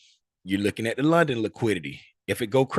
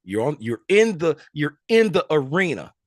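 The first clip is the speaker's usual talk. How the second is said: animated encouragement; 5 semitones higher, 4 dB louder than usual. Real, not acted. Teacher encouraging a student's answer.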